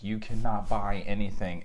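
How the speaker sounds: mock-strict rule, stress on cannot; hammed-up firm prohibition; hard, definitive rule. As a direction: lay down a pretend firm rule. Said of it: "is not a hard, definitive".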